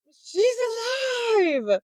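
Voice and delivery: excited exclaim. Retold or imitated. Thrilled retelling of the resurrection.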